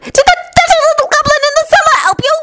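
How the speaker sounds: very high, screeching demand; furious scream. A narrator's reading. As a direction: shriek the accusation at a captive. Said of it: "they heard Bellatrix scream".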